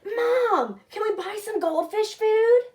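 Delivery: high, loud eager request; eager ask. Acted. Boy distracting his mother, high and eager. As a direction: ask all bright and keen.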